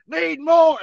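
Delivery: high, loud barked demand; drill yell. Retold or imitated. Sergeant yelling at pushups.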